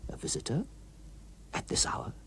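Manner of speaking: breathless whispered question; hushed whisper. Acted. Walter alone at night, hushed whisper. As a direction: whisper it, startled by the bell. Walter startled by late doorbell.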